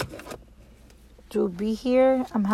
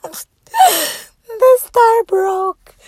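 slow, high laughing cry; laughing wail; 12 semitones higher, 9 dB louder, much slower than usual. Real, not acted. Star charm just broke.

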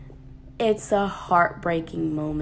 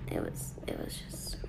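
whispering; 17 dB quieter than usual. Real, not acted. Exasperated aside.

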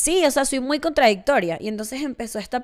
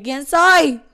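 a loud, higher, anguished cry of who am I; mock existential panic; 4 semitones higher, 9 dB louder than usual. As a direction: throw it out like a sudden identity crisis. Dramatic self-questioning.

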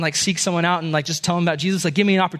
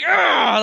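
angry groan; 8 semitones higher than usual. Retold or imitated. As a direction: groan it out in anger.

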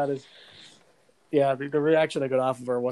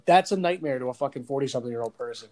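ordinary talk

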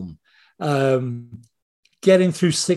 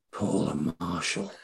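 very soft, low murmur; hushed reverence; 4 semitones lower, 9 dB quieter, slower than usual. Retold or imitated. Men in their forties recalling a first crush, hushed. Voicing fans' wistful crush confession.

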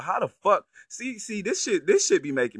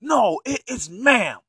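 loud, higher mock rage at the son; pretend furious parent; 7 semitones higher, 7 dB louder than usual. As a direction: blow up at the kid, hammed-up. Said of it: "what do you say to your son when he says".

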